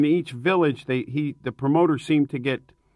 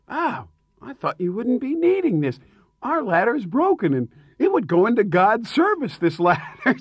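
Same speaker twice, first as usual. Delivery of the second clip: higher-pitched mock priest voice; imitated priest; 7 semitones higher than usual. Priest caught carrying off the crew's ladder, imitated high innocent excuse. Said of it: "the priest was carrying the ladder".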